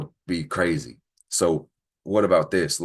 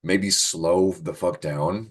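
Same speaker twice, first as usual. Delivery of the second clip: slow and deliberate; much slower than usual. Acted. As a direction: drag it out slowly. Advising to slow down.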